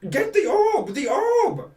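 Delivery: shrill, very high, loud alarm cry; high frantic yell from the tower top; panicked shout. Acted. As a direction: scream for the guards in panic.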